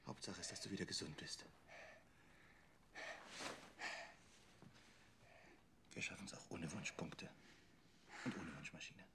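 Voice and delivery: soft, low, tender whisper; whispering tenderly. Acted. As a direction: whisper gently to comfort. Tender comfort to recovered Sams.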